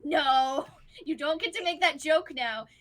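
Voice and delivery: loud, firm comic scolding; exasperated refusal. Acted. Wife to joking husband, exasperated refusal. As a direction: shut the joke down.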